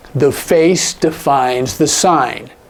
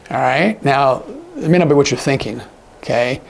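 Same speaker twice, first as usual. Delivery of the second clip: ordinary delivery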